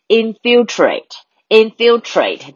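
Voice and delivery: infiltrate said slowly, twice, weight on fil; stress on second syllable. Shown as a demonstration. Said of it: "重音在第二个哦".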